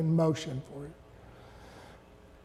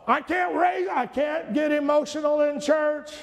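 much higher, loud hammed-up protest; hammed-up shouted excuse; mock indignant outburst; 8 semitones higher, 3 dB louder than usual. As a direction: play the angry churchgoer for laughs. Mocking a churchgoer's excuse.

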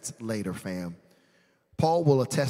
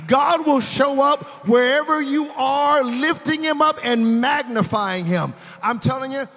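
loud, high preaching voice; rising shout of praise; preaching at full force; 7 semitones higher, 6 dB louder than usual. Acted.

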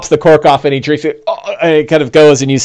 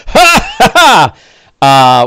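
high, taunting "ha, ha"; hammed-up gloating laugh; villain laugh; 4 semitones higher, much slower than usual. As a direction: laugh off a missed blow. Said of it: "he's like".

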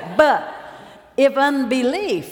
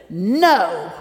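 firm no; 3 semitones lower, 5 dB louder than usual. Retold or imitated. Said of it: "pointed his finger and said".

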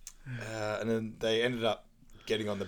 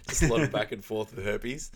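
usual speaking voice

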